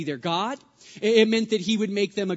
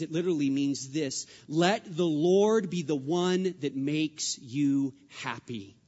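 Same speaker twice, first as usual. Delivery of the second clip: usual speaking voice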